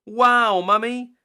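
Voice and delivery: high, loud excited cheer. Acted. Children cheering Mummy's pancakes, high and loud.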